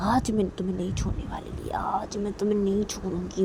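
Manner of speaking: soft menacing threat; quiet threat. Acted. (धीमी धमकी)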